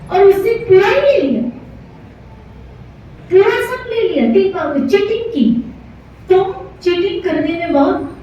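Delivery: high-pitched scolding, acted indignation; angry accusation. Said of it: "चिल्ला रहा है". (क्रोध में ऊँचे स्वर में उलाहना)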